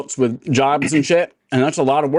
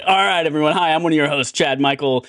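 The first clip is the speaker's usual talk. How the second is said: ordinary talk, nothing marked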